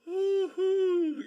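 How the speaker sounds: high, loud woo-hoo; excited whoop. Real, not acted. Streamer celebrating with viewers, high excited whoop.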